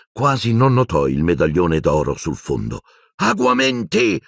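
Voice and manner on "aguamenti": high, drawn-out incantation; yelled spell. A narrator's reading. Boy conjuring water for his professor, yelled. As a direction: yell the spell urgently. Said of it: "urlò".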